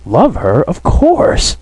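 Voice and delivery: plaintive, pitch leaping up at the end; plaintive emphasis. A narrator's reading. Sonia answers whether she loves her, with plaintive emphasis. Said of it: "said Sonia with plaintive emphasis".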